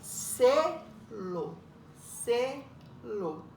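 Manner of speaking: "Selo" broken as se, lo with a pause; split into syllables. Shown as a demonstration. Teacher counting syllables for pupils, "Selo" split in two. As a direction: say "Selo" as two separate beats, then repeat. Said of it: "Então, tem duas sílabas".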